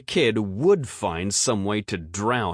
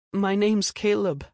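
put-on high sweet child voice; sweet little boy; 13 semitones higher than usual. A narrator's reading. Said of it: "the boy sweetly said".

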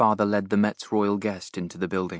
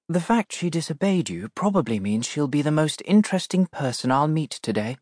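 lightly voiced Princess Diana; 6 semitones higher than usual. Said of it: "whispered Diana".

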